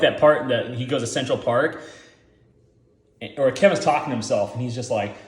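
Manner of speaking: ordinary talk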